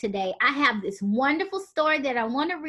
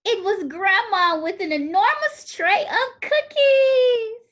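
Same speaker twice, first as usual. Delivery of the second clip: gasp, then cookies shouted much higher and louder; excited reveal; 8 semitones higher, 5 dB louder than usual. Acted. Storyteller revealing Grandma with a huge tray, excited shout. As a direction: build to a thrilled cry.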